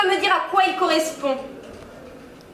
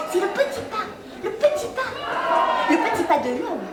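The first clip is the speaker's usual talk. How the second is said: higher, laughing sing-song; giggly riff; 7 semitones higher, 3 dB quieter, faster than usual. Acted. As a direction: toy with the phrase while cracking up. Giggly riff on the small step. (variation rigolote en riant sur le petit pas)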